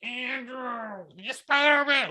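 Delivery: high mock-cheering voice; sarcastic high-pitched fan cheers; mocking yells. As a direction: tease him with fake excitement. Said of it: "people are just making fun of him".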